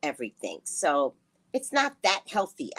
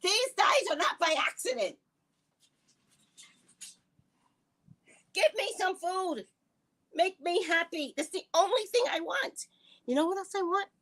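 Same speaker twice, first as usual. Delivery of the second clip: sassy boast; 9 semitones higher than usual. Real, not acted. Playful boastful show-off.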